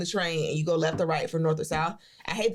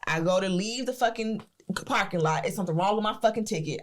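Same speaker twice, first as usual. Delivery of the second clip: ordinary talk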